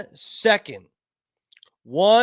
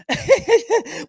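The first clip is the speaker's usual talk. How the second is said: mock cackle; 10 semitones higher, 4 dB louder than usual. Mocking Harris's cackle.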